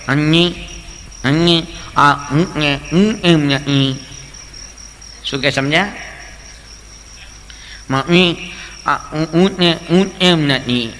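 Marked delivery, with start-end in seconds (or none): 0.1-4.0 slow, louder nasal slurring; nasal boy. Retold or imitated. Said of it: "છોકરો ગૂંગણો". 7.9-10.9 slow, louder nasal slurring; nasal boy. Retold or imitated. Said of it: "છોકરો ગૂંગણો".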